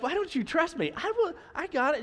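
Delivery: high-pitched mock exasperation; exasperated protest. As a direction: protest at not being trusted, pitch jumping up. Acted protest at not being trusted.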